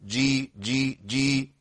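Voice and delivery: جي with a soft, quiet j sound; softened jim. Shown as a demonstration. Teacher showing students the proper jim sound, quietly. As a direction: say جي softly with a true j. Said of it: "آه، الجيم".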